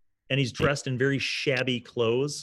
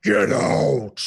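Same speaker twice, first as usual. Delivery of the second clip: booming Get out like over a PA system; thunderous Baron bellow; scary loud; 3 semitones higher, 7 dB louder than usual. Acted. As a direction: roar it so they jump. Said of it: "it is scary loud".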